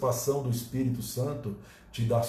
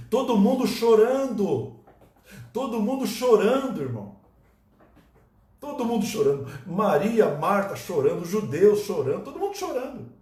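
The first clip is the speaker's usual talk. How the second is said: dramatic preaching; 6 semitones higher, 4 dB louder than usual. Acted. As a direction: paint the crying crowd. Dramatic retelling of mourning.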